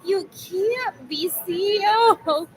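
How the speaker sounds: mock the exasperated mother, drawing it out; frustrated mother. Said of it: "she would get so frustrated".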